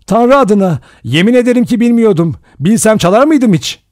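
loud, high, pleading protest; crying out. A narrator's reading. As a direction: swear innocence at the top of the voice. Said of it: "diye haykırdı Rogers". (haykırarak yemin eder gibi)

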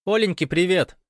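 loud, high shouted hello; called-out greeting. A narrator's reading. Lyoshechka sends Gosh's wife greetings, calling out. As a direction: shout greetings from another room. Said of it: "крикнул ему из комнаты Лёшечка".

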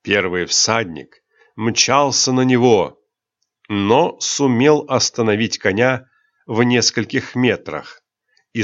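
plain reading voice

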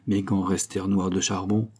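ordinary delivery

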